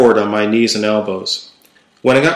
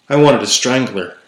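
ordinary delivery, nothing marked

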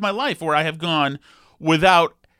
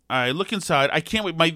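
usual speaking voice

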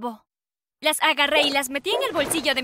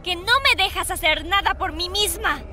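higher, resentful daughter lashing out; angry teen outburst; 5 semitones higher than usual. Acted. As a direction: spit it at the controlling mom. Daughter yells at controlling mother.

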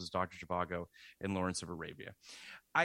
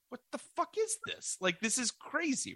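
outraged Irish viewer. Pretend. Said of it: "I'd be like".

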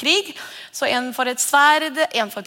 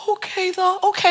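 resigned acceptance; 9 semitones higher than usual. Acted. We accept the lie.

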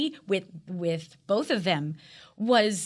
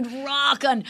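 higher-pitched mock dude cheer; Bill and Ted dude; 6 semitones higher, 4 dB louder than usual. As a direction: cheer it like a goofy rocker dude. Playful Bill and Ted catchphrase.

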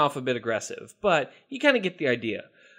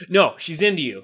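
ordinary talk, nothing marked

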